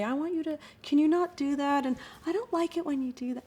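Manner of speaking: avoid the eyes and smile it away; timid indirect ask. Shown as a demonstration. To a girl bothering her, timid high sweet voice. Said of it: "I smile, I look away, ... the message doesn't match".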